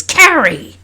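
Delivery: loud, high, scolding cry of the name; shocked mother's shout. A narrator's reading. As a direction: call out your child's name, scandalised. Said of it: "turns to her shocked and says".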